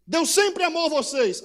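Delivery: loud, high proclamation of God's love; proclaiming prophet. Acted. Prophet proclaiming loudly to the people. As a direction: announce it boldly to the crowd.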